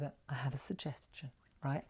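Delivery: a playful conspiratorial whisper. Shown as a demonstration. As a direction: lean in and whisper it. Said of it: "I look at me, old Japanese".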